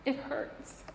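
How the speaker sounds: breathy whisper, barely there. Real, not acted. Mother admitting to the congregation that it hurts, whispered. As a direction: let it fall to a whisper of pain.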